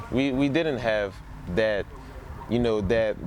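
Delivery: ordinary delivery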